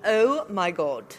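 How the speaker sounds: slow British accent, drawn out. Shown as a demonstration. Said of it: "that's your British accent".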